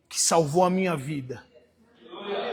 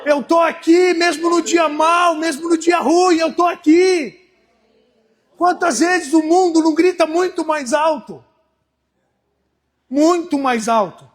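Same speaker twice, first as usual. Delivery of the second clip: "Eu tô aqui" proclaimed higher and louder, slow; sermon climax, slow and ringing; full-force preaching; 7 semitones higher, 6 dB louder, slower than usual. Acted. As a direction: proclaim it over the noise of the world.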